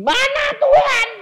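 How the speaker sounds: high, loud mock grumble; grumbling demand. Preacher imitating an impatient believer grumbling at God, high and loud. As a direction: whine it at God.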